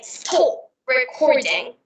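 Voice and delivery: pressurized, slow British accent. Shown as a demonstration. Demonstrating how pressurized British sounds, British accent. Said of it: "but in British, I think it's pressurized".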